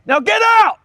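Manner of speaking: loud, high "get out"; angry command to leave; shouting. Real, not acted. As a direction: throw him out with a yell. Throwing the viewer out.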